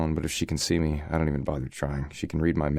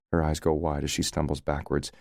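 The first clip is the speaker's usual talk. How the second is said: ordinary delivery, nothing marked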